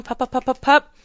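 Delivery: cheerful pup-pup to redirect the dog; upbeat interrupting call. Shown as a demonstration. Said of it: "a more upbeat tone".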